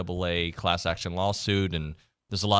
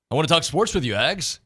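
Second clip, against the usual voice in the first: higher announcer hype, lightly imitated; radio intro voice; 6 semitones higher than usual. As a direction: pump it up like the show intro. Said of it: "the way I say it like a robot".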